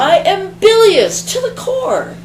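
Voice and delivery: high, loud furious outburst; bilious shouted high in a rage; furious shriek. Acted. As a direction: spit it out in a tantrum. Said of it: "She exploded".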